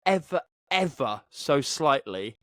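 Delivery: usual speaking voice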